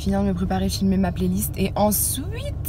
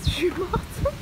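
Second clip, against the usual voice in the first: laughing; 10 semitones higher, 4 dB quieter, much slower than usual. Real, not acted. Laughing at the sudden storm.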